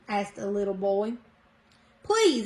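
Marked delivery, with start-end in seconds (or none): 2.0-2.5 high, pleading please; begging plea. A narrator's reading. Townsfolk begging Gretchen, high pleading. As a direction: beg them, voice lifted high. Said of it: "everyone begged".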